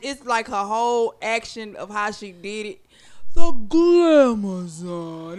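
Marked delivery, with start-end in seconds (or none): 3.3-5.4 slow, loud wrestler boast; Glamazon intro. Retold or imitated. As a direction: drag the name out like a ring entrance. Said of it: "you gotta see her do it".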